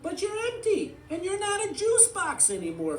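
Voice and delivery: very high, loud toy voice; high and insistent; Forky. A narrator's reading. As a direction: protest in a high cartoon pitch. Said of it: "Forky said".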